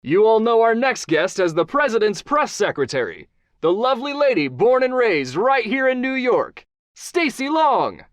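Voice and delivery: showman host intro. Acted. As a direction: introduce the guest like a big TV host, drawing out the name. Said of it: "She could hear Johnny introducing her".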